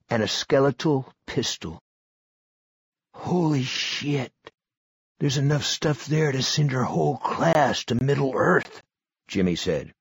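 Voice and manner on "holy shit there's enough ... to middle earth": Jimmy's voice. A narrator's reading.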